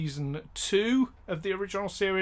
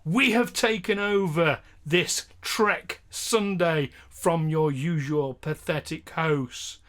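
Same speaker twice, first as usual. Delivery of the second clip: hammy evil overlord decree; dark lord. Acted. Mirror universe villain opening.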